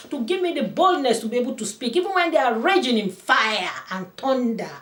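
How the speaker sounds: loud, high sermon delivery; impassioned preacher; fervent preaching. Acted. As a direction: proclaim it to the congregation. Preaching at full force.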